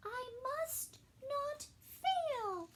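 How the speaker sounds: a small creature steeling herself; determined snail. Acted. Snail promising herself, slow and high. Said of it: "said the snail".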